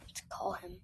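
whispered, rising on him. Shown as a demonstration. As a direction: breathe it out in a whisper.

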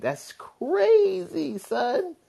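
very high, slow stretched amazement; amazed. Real, not acted. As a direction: drag it out in disbelief. Amazed at double yolks.

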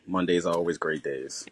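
deadpan sarcasm, pitched low; sarcastic. Real, not acted. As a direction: say it low with dry irony. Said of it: "Do you mean that sarcastically?".

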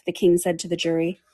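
ordinary delivery, nothing marked

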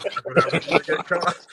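laughing through the casual line. Retold or imitated. Imitating the bouncer's casual remark, laughing. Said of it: "that is just so casual".

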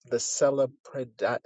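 ordinary delivery

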